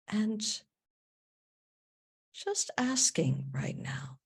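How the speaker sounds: soft meditation voice with long pauses; guided meditation. Acted. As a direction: guide them gently inward, hushed and unhurried.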